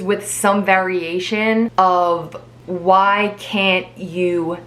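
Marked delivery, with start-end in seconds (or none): 2.7-4.6 drawn-out, mocking "why can't you just"; slow mocking quote. Vlogger mocking what people say, drawn out slowly. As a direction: stretch every word out slowly, with scorn. Quoting the dreaded phrase.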